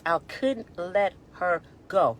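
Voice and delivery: slow, fighting back tears; almost breaking, slow; holding back tears. Real, not acted. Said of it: "Right now, I'm trying not to cry".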